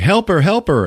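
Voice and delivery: high urgent pleading; mock kid pleading. John begging Arnold to save his mom, high plea. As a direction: beg like a frantic kid.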